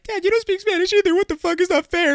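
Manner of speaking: put-on very high, loud kid whine; protesting kid. Kid calls dad unfair, high loud mock tantrum. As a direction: squeal it at dad, outraged. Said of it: "And I'd be like".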